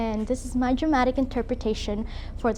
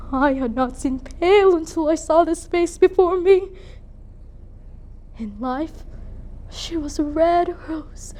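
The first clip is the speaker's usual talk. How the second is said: tragic stage monologue, swelling and sinking; grieving noblewoman, dramatic; 3 semitones higher, 4 dB louder than usual. Acted. Lady Capulet stands over her daughter's body, grieving. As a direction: lament with theatrical weight and long pauses.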